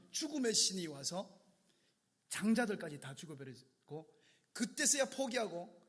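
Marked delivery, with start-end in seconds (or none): none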